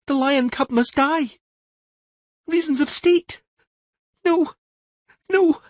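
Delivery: put-on high girl's voice, rising to a cry; a child reliving a terror; frightened girl in a trance. Siri in a trance crying out her fear to Tris.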